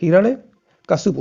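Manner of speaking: Hiranyakashipu said slowly and clearly, syllable by syllable; teacher slowing down on Hiranyakashipu; slow spelled-out word. Shown as a demonstration. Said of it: "क्या होता है?".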